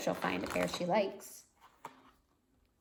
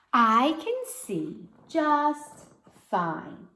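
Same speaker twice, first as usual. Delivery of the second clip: slow, higher, drawn-out insistence; stubborn little girl; 7 semitones higher, 4 dB louder, much slower than usual. Acted. Girl insisting to parents she needs nothing, stubborn drawl. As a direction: drag it out like a stubborn kid.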